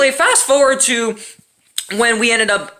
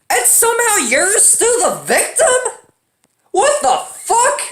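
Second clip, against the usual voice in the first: much higher, drawn-out disbelief; incredulous outrage; 12 semitones higher than usual. Real, not acted. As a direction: stretch the what fuck in shock. Ranting about ex's double standard.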